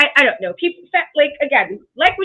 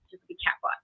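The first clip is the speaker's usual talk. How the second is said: breathy whispered joke, almost no voice; whispered aside; 12 dB quieter than usual. Real, not acted. Joking about being called a bot, whispered. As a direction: drop to a whisper for the punchline.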